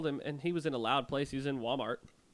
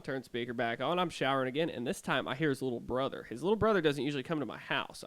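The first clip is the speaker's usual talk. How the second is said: usual speaking voice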